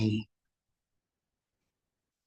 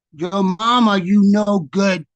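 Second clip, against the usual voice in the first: louder, higher-pitched insult thrown back; defiant kid's retort; 5 semitones higher, 7 dB louder than usual. Acted. Kid answers the voice that insulted him, loud defiant comeback. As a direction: fire back like a cheeky child.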